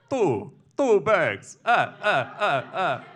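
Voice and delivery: vampire count. Acted. Count bidding for blood, punched vampire counting. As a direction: count out loud like a cartoon vampire. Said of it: "Good to see you, Count".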